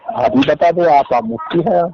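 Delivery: loud, heated jab at the cleric; sharp loud challenge in an argument; angry retort. Real, not acted. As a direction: throw it back at him, fed up. Caller snaps at the mufti.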